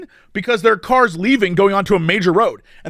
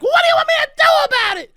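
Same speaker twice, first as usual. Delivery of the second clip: much higher, fast mock shout; mock exasperated woman; much faster than usual. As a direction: throw it back at him, fed up. Retold woman snapping at him.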